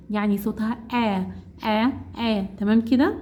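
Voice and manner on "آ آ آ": "آ" as the open "a" of cat; short A sound. Shown as a demonstration. Teacher shows how short A sounds, "آ" open as in cat. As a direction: sound out "آ" as short English A, each one separate. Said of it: "شورت يعني هيسمعني صوته".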